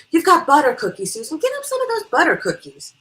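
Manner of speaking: loud, high-pitched bossy urging; calling out orders. Acted. Guest telling hostess to fetch cookies, bossy call. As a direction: call it out to the friend fetching refreshments.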